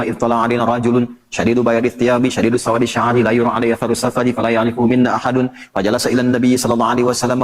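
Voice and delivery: quick, even recitation of Arabic scripture; hadith recitation. Acted.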